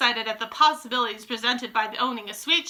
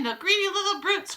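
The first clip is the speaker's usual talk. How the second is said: higher-pitched excited crowd cry; 10 semitones higher than usual. A narrator's reading. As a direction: voice an audience crying out. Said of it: "cried the audience".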